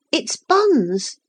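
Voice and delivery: put-on high, eager little girl; a child jumping in to answer about bears; girl blurting out. A narrator's reading.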